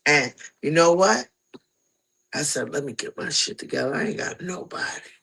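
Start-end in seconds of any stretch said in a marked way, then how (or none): 2.7-5.1 quiet, cracking, half-whispered resolve; softly breaking retold vow; voice about to crack. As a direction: say it to yourself, close to tears. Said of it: "My, my, my voice about to crack".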